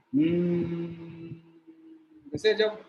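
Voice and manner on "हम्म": long slow steady hum through closed lips; bee-like humming. Shown as a demonstration. Teacher demonstrates bhramari breath for students, long humming buzz. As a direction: hum like a buzzing bumblebee, drawn out. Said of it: "थोड़ा मैं तेज बोलता हूँ".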